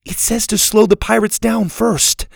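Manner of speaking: put-on high-pitched girl's voice; urgent young girl. A narrator's reading. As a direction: pass on the instruction, high and urgent.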